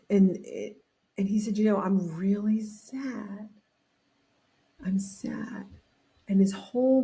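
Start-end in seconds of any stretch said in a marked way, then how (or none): 1.6-5.7 whispered, sad admission; hushed sadness. Retold or imitated. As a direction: breathe it out like a confession. Chuck admits he feels sad.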